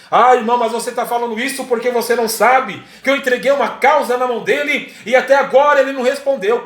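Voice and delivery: impatient objection. Acted. Believer objects that God still has not answered, impatient protest.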